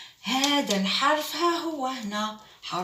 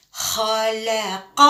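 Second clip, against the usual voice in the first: slow syllables; much slower than usual. Shown as a demonstration. Teacher drilling خلق slowly with the class. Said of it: "teacher models reading slowly".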